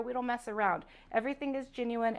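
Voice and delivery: usual speaking voice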